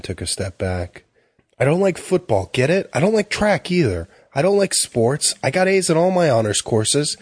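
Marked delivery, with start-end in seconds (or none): 1.6-5.3 put-on high, rising teen outburst, voice climbing; angry outburst. A narrator's reading. Teen yelling at the salesman and his family, angry outburst. Jamie finally bursts out. 5.4-7.2 high, heated repeat; angry. A narrator's reading. Teen repeating his grades to the salesman, heated.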